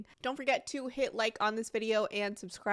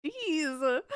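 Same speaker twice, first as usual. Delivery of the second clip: higher, teary voice with a laugh breaking through; choked up but laughing at herself; real emotion, laughing it off; 7 semitones higher, 5 dB louder than usual. Real, not acted. To her viewers, moved near tears, laughing through it. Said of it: "I'm getting emotional now".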